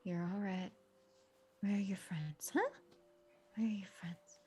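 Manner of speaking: hushed, near-whispered comfort; very soothing. Acted. Drover massages the steer's ear, very soothing. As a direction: softly soothe a spooked animal. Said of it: "just very soothing, like".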